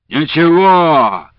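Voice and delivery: put-on slow, loud, drunken hiccup. A narrator's reading. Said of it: "пьяно икнул".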